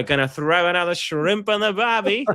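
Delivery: put-on Australian accent. Quoting Dumb and Dumber to co-host, hammed-up Aussie accent. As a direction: quote the movie in a cheesy Australian accent. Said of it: "I got it from Dumb and Dumber".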